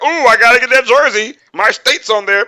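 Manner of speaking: high, loud gushing fan; mock a gullible fan hyped over a jersey; dumb fan. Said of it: "a lot of dumb fans like".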